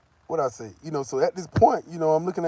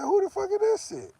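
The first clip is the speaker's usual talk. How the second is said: much higher, mock shock; outraged disbelief. Reacting to a stranger's ID, much higher outraged disbelief. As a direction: yelp it in disbelief. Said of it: "I said".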